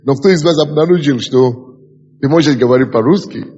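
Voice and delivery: usual speaking voice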